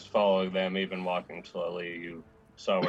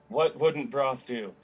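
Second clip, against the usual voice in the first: higher, slow emphatic outburst; blurted out; 4 semitones higher, 4 dB louder, slower than usual. Acted. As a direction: blurt the question out in character. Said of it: "you blurt out".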